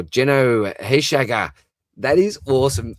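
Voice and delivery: thrilled to see the viewer's name; excited shout-out. Real, not acted. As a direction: call out the name with glee.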